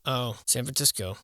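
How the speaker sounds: usual speaking voice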